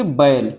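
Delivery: बैल drawn out as a gliding ba-el; combined vowel. Shown as a demonstration. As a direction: glide through two vowels in बैल. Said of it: "पहले आपने बे से शुरू किया".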